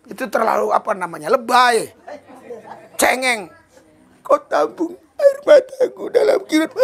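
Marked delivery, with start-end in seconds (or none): 4.2-6.7 high, tearful, hammed-up sob; mock crying prayer. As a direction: pretend to weep through the prayer. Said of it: "Itu nangis-nangisin". (pura-pura menangis, suara tinggi)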